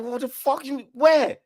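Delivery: high, alarmed what-the-fuck; jolted by bad news; shocked alarm. Hearing that a friend got caught, high shocked alarm.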